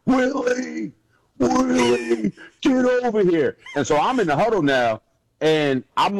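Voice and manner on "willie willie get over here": high calls from the sideline; coach screaming name. Retold or imitated. Coach calling the player over from the sideline, high yelled summons. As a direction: yell the name across the field, high. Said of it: "I can hear B C screaming".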